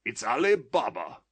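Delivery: high, loud cry of delighted recognition; an old man overjoyed by a childhood memory; ecstatic exclamation. A narrator's reading. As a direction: burst out in ecstasy. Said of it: "Scrooge exclaimed in ecstasy".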